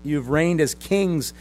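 loud, slow, drawn-out mockery; sarcastic praise. Preacher as Paul mocking boastful believers, loudly sarcastic. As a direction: pour contempt on their pride, loudly and slowly. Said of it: "pours out some spiritual sarcasm".

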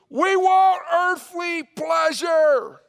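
very high, drawn-out mob shout; shouting crowd. Acted. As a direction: bellow it slowly like a demanding crowd. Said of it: "But the crowd goes".

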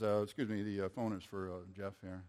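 low, soft, hesitant aside; trailing down to a near mumble; hushed interruption. Retold or imitated. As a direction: imitate someone discreetly interrupting a meeting. Staff member interrupts the speaker.

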